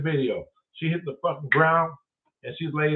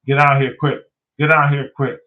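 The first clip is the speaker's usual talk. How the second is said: louder urgent summons; urgent call; 6 dB louder than usual. Retold or imitated. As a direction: hurry him over, loud and pressing. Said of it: "When Daryl talked like that".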